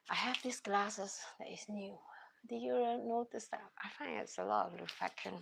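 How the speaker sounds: unvoiced whispered aside; whispering. Real, not acted. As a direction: whisper it like a little secret. Aside about new glasses.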